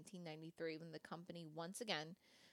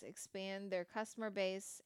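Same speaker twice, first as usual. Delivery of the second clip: ordinary delivery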